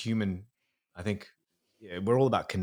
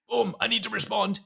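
sharp gasp, then a much higher startled reaction; impulsive gasp; 12 semitones higher than usual. Acted. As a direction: play the jumpy reptile brain. Acting impulsive reptilian brain.

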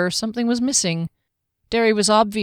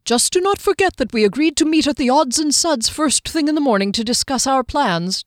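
higher, careful, proper voice of a fussy companion; prim Giskellen; 7 semitones higher, 4 dB louder than usual. Acted. Giskellen fussily reminding Kier of the morning meeting, higher and prim. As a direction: remind them of the meeting like a stickler.

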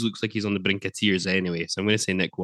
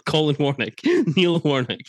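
laughing; 7 semitones higher, 4 dB louder, slower than usual. Real, not acted. Corrects name while laughing.

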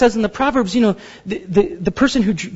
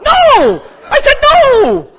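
frustrated shout; 8 dB louder, much slower than usual. Retold or imitated. Agent mishears, speaker yells.

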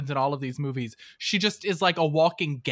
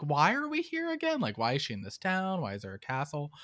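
puzzled, pitched up then sinking away; baffled viewer; 2 semitones lower, 8 dB quieter than usual. Retold or imitated. Confused viewer questioning the plot, baffled.

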